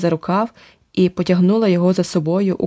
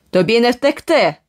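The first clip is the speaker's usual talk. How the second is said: menacing roar; 5 semitones higher than usual. Acted. Thug roaring a threat at the hiding pair, menacing shout. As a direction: bellow the threat from behind. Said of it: "проривів із-за сараю грізний голос".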